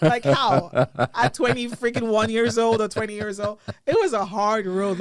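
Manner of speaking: how was that ever possible; exasperated. Real, not acted. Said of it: "you wanted to get a job as a politician".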